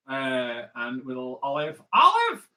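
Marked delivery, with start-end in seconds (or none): 1.9-2.5 very high, loud squawked exclamation; sudden hammed-up cartoonish cry; comic impression. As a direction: leap up high and yell it. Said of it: "All the impressions are coming out today".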